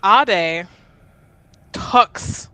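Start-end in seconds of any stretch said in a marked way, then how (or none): none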